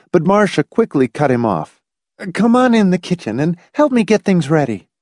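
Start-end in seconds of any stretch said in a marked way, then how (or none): 2.3-4.9 high, firm wifely order; clear, commanding. A narrator's reading. Wife cutting off her husband, commanding. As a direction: take charge, high and crisp. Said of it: "in a clear, commanding voice".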